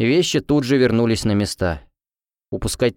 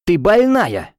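higher, louder irritated shout; angry outburst; 9 semitones higher, 5 dB louder, much slower than usual. Acted. Roommate woken at night, yelling in anger. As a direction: snap at her, fed up and half-awake. Roommate yells on waking her.

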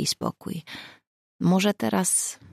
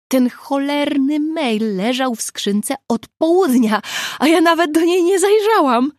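disbelief; 10 semitones higher, 7 dB louder than usual. A narrator's reading. Nina exclaiming in disbelief.

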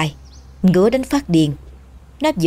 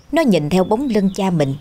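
ordinary delivery

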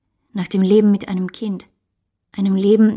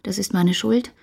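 ordinary talk

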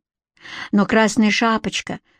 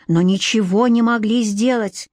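usual speaking voice

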